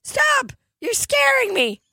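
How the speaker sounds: very high squeal; squeaky cartoon reply. Mister Hanky answering Tony, very high squeak. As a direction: chirp it in a tiny cartoon voice.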